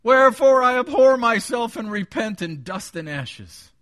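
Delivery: high, loud cry of repentance; Job. Acted. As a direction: declaim it as an anguished confession. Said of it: "You know how Job said it?".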